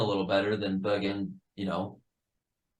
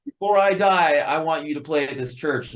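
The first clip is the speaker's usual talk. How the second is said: lightly voiced grandma plea, pitched up; imitated grandma; 6 semitones higher, 4 dB louder than usual. Bandmate quoting wife's grandma's church request, imitated plea. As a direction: retell a grandma's insistent request, higher. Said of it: "because she's like".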